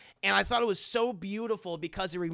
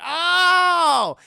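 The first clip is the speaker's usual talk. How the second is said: delighted realization; 12 semitones higher, 12 dB louder than usual. Real, not acted. Realizing the title meaning.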